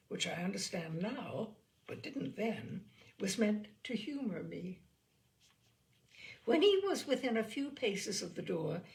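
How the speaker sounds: ordinary delivery, nothing marked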